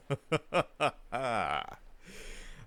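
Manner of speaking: a long amused laugh; hearty laugh. Acted. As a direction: laugh it off, pleased with the answer. Amused by the rookie's answer.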